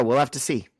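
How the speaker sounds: ordinary talk, nothing marked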